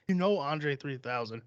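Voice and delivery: ordinary delivery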